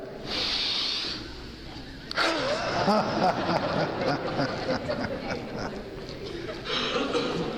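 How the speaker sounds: long group-style belly laugh; laughter exercise. Shown as a demonstration. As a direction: let the laugh roll out. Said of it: "Pretty much fake laughter right now".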